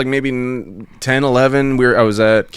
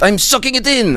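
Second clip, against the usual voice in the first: put-on French accent; 13 semitones higher than usual. Voicing the French kid.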